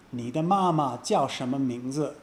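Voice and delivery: ordinary talk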